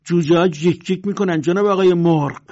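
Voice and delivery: sting the bully with mockery; defiant sharp reply; angry retort. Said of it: "با غضب گفت".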